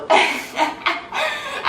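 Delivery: high, soft giggle; little giggle after the moo; light laugh. Real, not acted. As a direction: laugh at your own moo.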